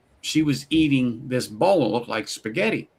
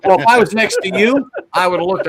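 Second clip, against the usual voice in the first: louder, higher comeback; loud joking; 7 semitones higher, 7 dB louder, faster than usual. Real, not acted. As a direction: banter back loudly. Jokey comeback.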